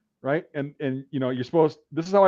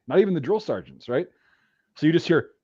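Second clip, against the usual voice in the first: ordinary talk, nothing marked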